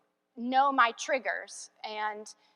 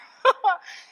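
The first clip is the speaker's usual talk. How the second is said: high delighted burst of laughter; loud happy laugh; real laugh; 9 semitones higher, 8 dB louder than usual. Real, not acted. Laughing at his reply.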